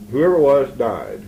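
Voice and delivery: ordinary delivery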